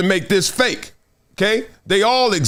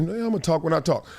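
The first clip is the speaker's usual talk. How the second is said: mock Draymond; 6 dB quieter, faster than usual. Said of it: "Damn, Draymond mad at me. He said".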